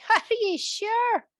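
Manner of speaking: high, slow teasing laugh. Acted. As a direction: tease him through a laugh. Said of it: "laughed Cook".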